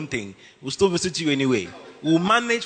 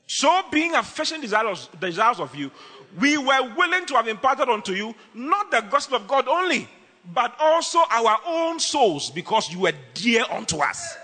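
scripture reading; 4 semitones higher than usual. Acted. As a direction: preach the verse.

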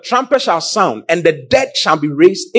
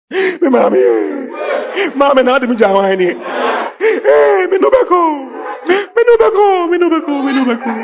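hammed-up, high-pitched hopeless crying; mock funeral wailing; 12 semitones higher, slower than usual. Preacher imitating mourners without hope, mock wailing. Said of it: "cry like people who don't have hope".

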